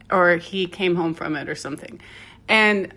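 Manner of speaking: ordinary talk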